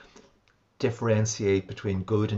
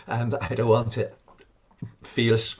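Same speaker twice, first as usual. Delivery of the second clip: louder, mock angry refusal; imitating the patient's louder angry protest; retold angry refusal; 5 dB louder than usual. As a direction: push the refusal out, half acting her anger. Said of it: "she was very angry with me".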